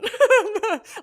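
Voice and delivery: a loud, high burst of laughter. Real, not acted. Laughing at her own story.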